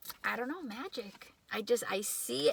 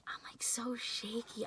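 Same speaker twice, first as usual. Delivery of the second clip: whispered, softer nervous admission; hushed, jittery aside; 6 dB quieter than usual. Real, not acted. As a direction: confess your nerves in a whisper. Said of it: "My voice is very shaky right now".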